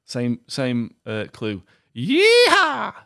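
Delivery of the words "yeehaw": high, loud cowboy whoop; cowboy accent. Pretend. Quizmaster giving the same clue, shouted cowboy accent. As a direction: holler like a mock cowboy, cowboy accent.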